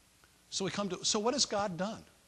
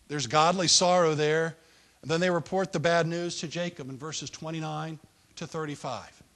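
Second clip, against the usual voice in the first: ordinary talk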